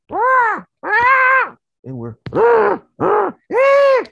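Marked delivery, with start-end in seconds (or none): 0.1-1.6 loud, very high ape cries; ape screech. Retold or imitated. Other ape man answering him, loud screeching. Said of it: "So he goes back". 2.3-4.0 loud, high screeches traded back; ape screeches. Retold or imitated. Two frustrated ape men back and forth, screeching. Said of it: "back and forth".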